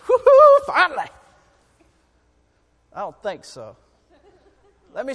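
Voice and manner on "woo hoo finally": a sudden high, loud whoop of joy; mock gleeful whoop. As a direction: whoop it out like it's a treat. Mock glee at disciplining child.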